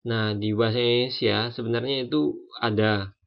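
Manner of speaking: ordinary delivery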